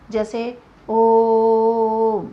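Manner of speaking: ओम held three times long; drawn-out pluta vowel. Shown as a demonstration. Teacher drawing out ओम to show pluta vowel. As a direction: stretch the ओ in ओम very long and slow. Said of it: "तीसरा है प्लुत स्वर".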